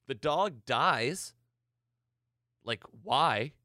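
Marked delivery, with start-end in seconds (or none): none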